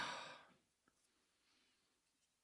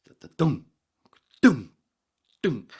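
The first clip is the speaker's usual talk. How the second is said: pounding thumps; 11 semitones higher, 20 dB louder than usual. Retold or imitated. Imitating heartbeat pounding.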